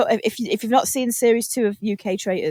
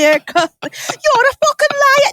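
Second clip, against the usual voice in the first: much higher, slow, loud accusing yell in a put-on accent; shrill mock shout; 6 dB louder, much slower than usual. Contestant screaming accusation at another player, hammed-up. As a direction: stretch it out in a screechy regional voice. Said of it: "different accents shouting angrily".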